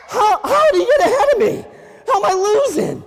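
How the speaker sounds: very high, loud squealed shout; panicked rabbit. Acted. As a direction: shriek in disbelief, hammed up. Rabbit panics at being behind.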